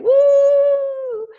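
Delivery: high, loud celebratory whoop; cheering woo. Real, not acted. Pastor cheering the graduate with a loud woo.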